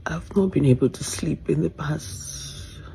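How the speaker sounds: hushed whisper at night. Real, not acted. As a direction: whisper so no one hears. Late-night distressed whisper video.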